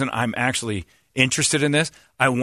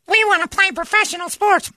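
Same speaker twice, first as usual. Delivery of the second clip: very high, hammed-up girly voice; mock girly whine; 4 dB louder than usual. Mocking the girls who want to play, squeaky. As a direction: squeak it like a petulant girl.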